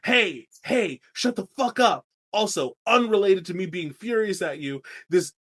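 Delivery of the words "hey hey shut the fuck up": higher, mock angry snap; angry Bran. Bran telling Jojen and Mira to shut up, mock angry. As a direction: tell them off, hammed-up. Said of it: "Bran's like".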